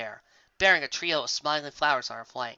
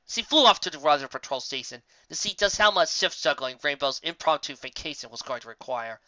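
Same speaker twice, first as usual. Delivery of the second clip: ordinary delivery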